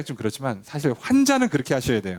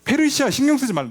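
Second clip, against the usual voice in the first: commanding declaration; 11 semitones higher, 4 dB louder than usual. Retold or imitated. God declaring to the governor.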